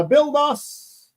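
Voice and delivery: high, slow sing-song reading of "build us"; drawn-out name read. Real, not acted. Reading a viewer's name aloud on the live stream, high and drawn out.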